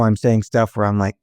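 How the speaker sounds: ordinary talk, nothing marked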